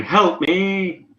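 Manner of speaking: high cry for help, not full volume; mock scream for help. Game master voicing a woman on the island, mock scream. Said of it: "Along with another scream. This one, this time saying".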